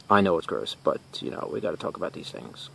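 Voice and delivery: low, hushed aside dropping to near whisper; confiding aside. Acted. Host excusing gross dead things to viewers, hushed confiding aside. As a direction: confide it very softly, trailing into whisper.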